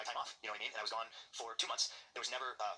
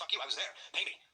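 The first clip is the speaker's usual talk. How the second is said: higher, forceful retold outburst; mild mock yell; retold screaming match; 8 semitones higher, 3 dB louder than usual. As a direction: throw the argument back, short of a scream. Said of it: "a huge screaming match with Vince".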